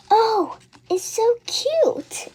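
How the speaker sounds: high, gushing delight. Acted. Girl cooing at the newborn dragon, high and delighted. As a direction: squeal over something adorable.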